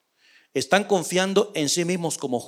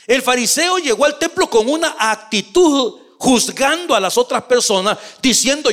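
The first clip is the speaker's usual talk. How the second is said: raised, ringing pulpit voice, driving each phrase home; preaching at full force; 4 semitones higher, 4 dB louder than usual. Acted. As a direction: preach it to the congregation with fervor. Pastor preaching the parable. (predicando con fervor desde el púlpito)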